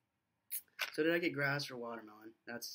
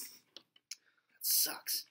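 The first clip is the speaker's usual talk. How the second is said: whispered; 4 dB quieter than usual. Real, not acted. Disgusted after tasting.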